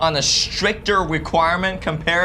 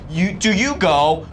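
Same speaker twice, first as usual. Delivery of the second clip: ordinary talk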